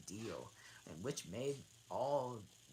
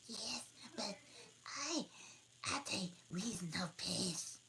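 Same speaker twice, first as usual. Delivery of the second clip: high voice fading to a whisper; dormouse voice; 9 semitones higher than usual. Acted. Dormouse answering Alice, high then whispered. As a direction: squeak then hush it. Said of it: "said the dormouse".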